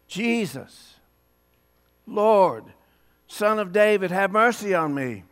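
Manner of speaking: calling out, not yet at full volume; blind man crying out. Retold or imitated. Blind man calling to passing Jesus, moderate cry. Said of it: "yeah, louder than that".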